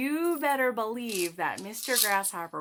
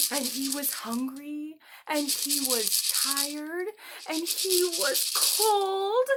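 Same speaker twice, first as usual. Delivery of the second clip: building pity; 7 semitones higher, 5 dB louder, slower than usual. A narrator's reading. Hungry, tired, cold grasshopper.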